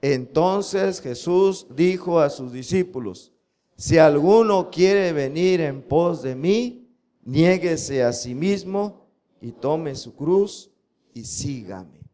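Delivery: slow, word-by-word memory verse reading; slow, measured scripture recitation. Shown as a demonstration. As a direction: drill the verse slowly for the class to repeat. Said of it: "Otra vez".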